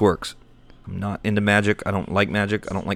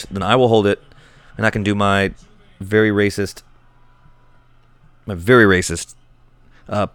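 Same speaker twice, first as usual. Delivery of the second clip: ordinary talk, nothing marked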